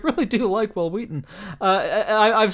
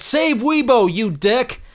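mock outrage; 7 semitones higher, 4 dB louder, slower than usual. Tweet read in mock outrage.

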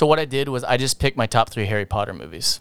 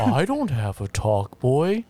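slowed, dragged-out recorder voice; Talk Boy voice; slower than usual. Pretend. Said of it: "you sound like you're doing a talk boy".

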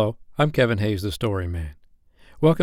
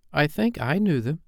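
put-on higher, mildly uncertain child's voice; rather doubtful; 7 semitones higher than usual. A narrator's reading. Michael following John's claim, rather doubtful. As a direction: say it with some doubt. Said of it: "said Michael rather doubtfully".

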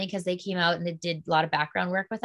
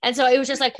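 usual speaking voice

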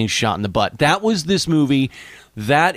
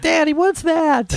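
put-on high, slow child's voice asking innocently; squeaky little kid asking daddy; slower than usual. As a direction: play a small confused kid at the movies. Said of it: "And they were like".

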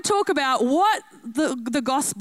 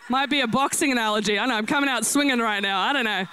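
ordinary talk, nothing marked

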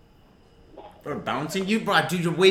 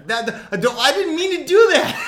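laughing protest; 10 semitones higher, 6 dB louder than usual. Real, not acted. Comedian teased over his botched accent, high laughing protest. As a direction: plead innocence while cracking up.

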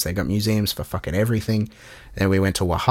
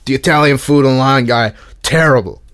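higher, louder mock New York tout, Lygon said Lion Guy; tout's voice; 5 semitones higher, 8 dB louder than usual. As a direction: sneer terrible like the scamming tout. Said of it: "Can't do the voice without coughing".

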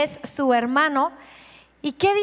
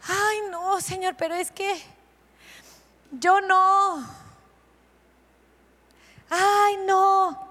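reluctant excuse; 4 semitones higher, slower than usual. Retold or imitated. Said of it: "Cuántos pretextos a veces ponemos".

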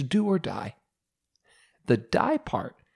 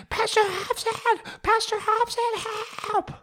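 very high, drawn-out shriek for help; panicked scream; slower than usual. Retold or imitated. Drifting out to sea, screaming for the youth pastor, high panicked shriek. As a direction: cry out for the pastor like a siren. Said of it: "I screamed out".

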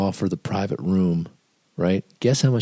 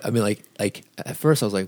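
ordinary talk, nothing marked

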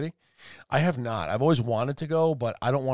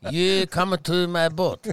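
put-on slow, drawn-out caricatured Chinese accent; hammed-up emperor in a put-on Chinese accent; mock Chinese accent; 3 dB louder, slower than usual. Emperor luring the sexy animals, mock Chinese accent. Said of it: "can you do a Chinese accent?".

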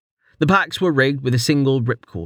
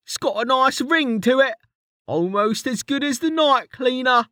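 much higher, cheeky rough-edged mate ribbing his friend; Kettle's high teasing voice; 13 semitones higher than usual. A narrator's reading. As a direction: tease him in a high jokey voice.

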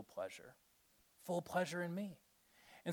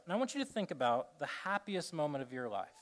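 slowing, fading meditation voice; hushed guided meditation trailing off; guided reflection; 3 semitones lower, 4 dB quieter than usual. Acted. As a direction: ease them into memory, softer and softer.

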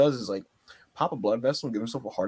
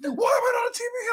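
mock shout. Podcaster mocks wrestler demanding TV time, high shout. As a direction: demand it like a spoiled heel. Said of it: "He's like".

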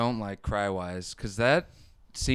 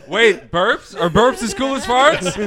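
excited; 11 semitones higher, 10 dB louder than usual. Real, not acted. Excited question about burps.

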